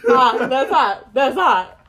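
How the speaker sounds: loud, slow, lightly voiced Will Smith line; Will Smith impression. Imitating Will Smith from YouTube Rewind, loud and slow. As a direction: quote the Rewind meme with a light drawl. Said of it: "That's Will Smith from the YouTube Rewind".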